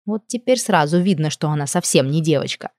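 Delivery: ordinary delivery, nothing marked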